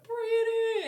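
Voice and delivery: high, loud sing-out call; mock catcall. Friends spotting him driving down the street, mock catcall. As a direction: holler the name teasingly from afar. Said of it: "we'd catcall them".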